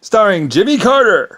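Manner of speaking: high, loud, drawn-out billing; mock announcer. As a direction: announce the star in a big slow shout. Mock show announcer credit.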